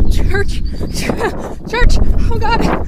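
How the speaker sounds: gasping, frantic panic; running scared, breathless; terrified. Real, not acted. Running in terror.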